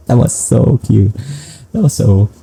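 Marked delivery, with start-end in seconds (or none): none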